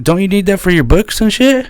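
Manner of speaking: retold question; mock disbelief. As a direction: sound baffled.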